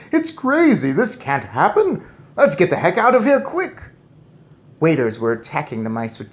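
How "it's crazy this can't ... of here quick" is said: high, loud panicked yell; panicked shouting. Acted. Strong men fleeing the mice, panicked shout.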